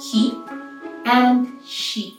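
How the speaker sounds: plain reading voice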